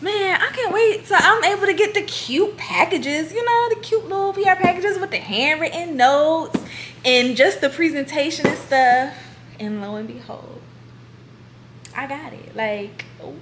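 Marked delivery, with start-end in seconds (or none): 0.0-9.1 eager wish. Retold or imitated. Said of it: "I was like".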